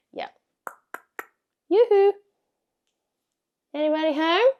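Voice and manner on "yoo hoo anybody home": high, loud sing-song call into the house; yoo-hoo up high, then call out. Retold or imitated. Coming home and calling into the house, loud and high.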